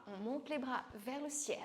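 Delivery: ordinary talk, nothing marked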